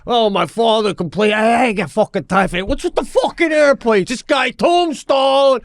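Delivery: high, agitated griping voice; riled-up complaint. Acted. Co-host mimicking the other's complaints about airplanes, agitated high rant.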